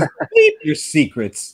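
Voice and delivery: slow, high, laughing mock wizard line; mock Gandalf. Retelling a funny film edit, laughing mock wizard line. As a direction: drag it out and giggle through it. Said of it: "then it goes".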